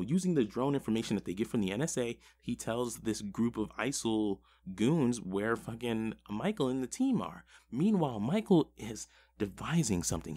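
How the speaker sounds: usual speaking voice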